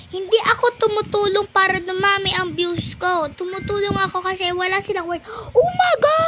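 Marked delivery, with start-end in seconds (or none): 5.6-6.2 loud call. Real, not acted. Shouting a greeting to another player.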